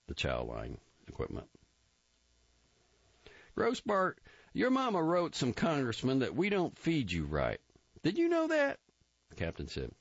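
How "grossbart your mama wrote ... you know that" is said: captain's voice. A narrator's reading. Captain taunting a recruit about his mother, mocking higher voice. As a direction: needle him like a scornful captain.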